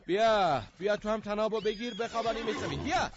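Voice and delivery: loud, high strained call; straining shout. Acted. Yelling at his friend to grab the rope, straining. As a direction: call him over while wrestling the rope.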